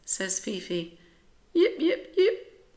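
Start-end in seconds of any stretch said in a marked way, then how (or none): 1.5-2.6 very high yappy yips; small dog yipping. A narrator's reading. Tiny sparkly dog yips at Rosie, very high and yappy. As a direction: yap like a tiny excited dog.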